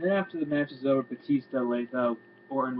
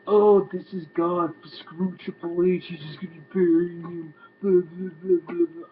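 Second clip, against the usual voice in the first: mock outraged fan; 4 semitones higher, 5 dB louder than usual. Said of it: "people are gonna be like".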